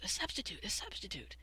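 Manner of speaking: hushed, passing the word along; breathless whisper of news. Acted. Child whispering the news to classmates, hushed. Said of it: "somebody whispered to somebody else".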